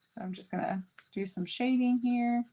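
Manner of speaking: ordinary delivery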